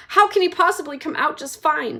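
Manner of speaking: high, loud snap; mother snapping at Wonka. Acted. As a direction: snap at him. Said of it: "snapped Missus Gloop".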